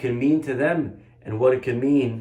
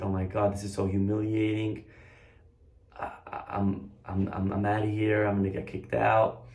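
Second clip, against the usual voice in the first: softer, lower, stammering dread; embarrassed panic; 5 semitones lower, 6 dB quieter than usual. Retold or imitated. Boy realizing it's the rabbi, mortified and scared. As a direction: sink into mortified fear.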